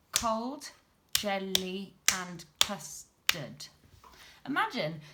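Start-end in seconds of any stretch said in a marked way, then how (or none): 0.1-3.7 syllable beats. Shown as a demonstration. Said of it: "our last line".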